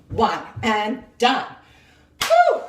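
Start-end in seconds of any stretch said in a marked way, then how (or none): 2.2-2.6 relieved whew. Real, not acted. Relieved exhale after countdown.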